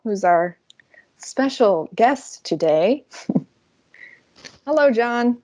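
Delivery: usual speaking voice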